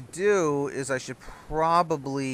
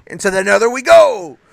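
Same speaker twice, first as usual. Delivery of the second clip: loud rallying shout; battle cry; 8 semitones higher, 11 dB louder than usual. Real, not acted. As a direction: charge in with a cry. Battle cry entering Nether.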